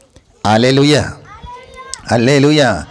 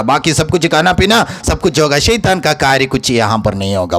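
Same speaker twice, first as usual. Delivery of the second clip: normal talking voice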